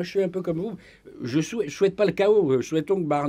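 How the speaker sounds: normal talking voice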